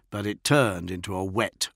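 ordinary delivery

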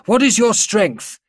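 high, loud, slow demand; tight, drawn demand. A narrator's reading. General to tank commander over vox, tight demand. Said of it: "Gruber's voice was tight and drawn".

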